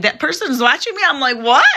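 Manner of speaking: high, loud, excited self-quote. Retold or imitated. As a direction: reenact your own startled excitement. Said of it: "sometimes I get excited".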